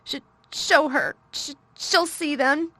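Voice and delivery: high, loud broken sobs; boy gasping out through sobs; gasping between sobs. A narrator's reading. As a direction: stammer it out gasping. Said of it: "he gasped out between two sobs".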